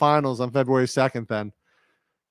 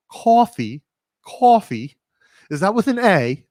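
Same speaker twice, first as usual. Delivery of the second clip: put-on London accent, pitched up, querying the word; mock London accent; 6 semitones higher than usual. British friend mimicking how he said coffee, mock London accent. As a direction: imitate a puzzled Londoner's proper accent. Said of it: "in her, like, proper London accent".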